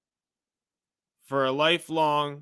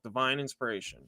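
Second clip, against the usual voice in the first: Divine inspiration repeated much softer and lower, reverent; 4 semitones lower, 10 dB quieter, faster than usual. Shown as a demonstration. Repeating the term to his viewers, softer and lower. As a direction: let Divine inspiration sink in quietly. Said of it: "That's what it's called".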